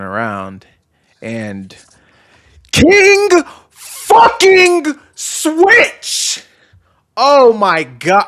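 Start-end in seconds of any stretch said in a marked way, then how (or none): 2.7-6.4 high, loud, drawn-out yell; excited shout. Retold or imitated. Fan reliving the wrestler's surprise return, excited shout. 7.2-8.2 loud, drawn-out exclamation; overwhelmed excitement. Real, not acted. Fan reliving a favourite's return, overwhelmed.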